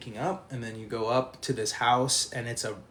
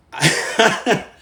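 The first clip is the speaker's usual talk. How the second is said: loud, high burst of laughing; real laughter; 9 semitones higher, 10 dB louder than usual. Real, not acted. Storyteller recalling Jimmy puking, bursting out laughing. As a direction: crack up helplessly. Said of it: "I'm laughing".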